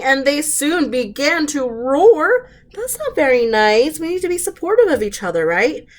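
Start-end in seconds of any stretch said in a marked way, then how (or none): none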